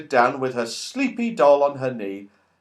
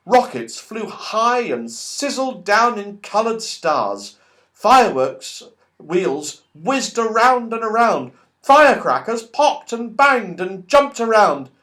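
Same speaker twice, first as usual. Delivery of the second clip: excited narration; 9 semitones higher, 4 dB louder than usual. A narrator's reading. Narrating the firework display.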